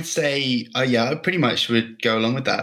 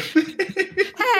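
squealing laugh, much higher; high burst of laughter. Real, not acted. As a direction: let out a high shriek of laughter.

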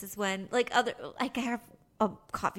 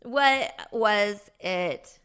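slow insistent; 5 dB louder, much slower than usual. Pretend. Drawn out insistent question.

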